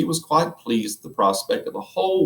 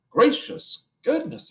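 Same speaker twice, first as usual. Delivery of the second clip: astonished exclamation; slower than usual. Acted. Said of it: "exclaimed Missus Popper".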